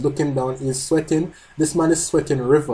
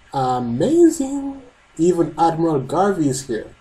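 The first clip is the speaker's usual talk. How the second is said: amazed exclamation; 3 semitones higher than usual. Acted. Reading a character's amazed line.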